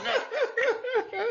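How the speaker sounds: high, giggling no; high giggling old lady; laughing high refusal. Retold or imitated.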